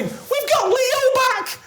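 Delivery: very high, slow, drawn-out cheer; imitated overjoyed daughter. Daughter rejoicing over the cat, imitated as high slow cheer. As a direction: squeal it in mock delight. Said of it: "my daughter's going".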